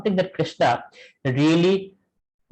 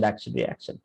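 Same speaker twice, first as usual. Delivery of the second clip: fading away; 11 semitones lower, 8 dB quieter than usual. Shown as a demonstration. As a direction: drop much lower and softer, trailing off. Repeating karma cycle fading out.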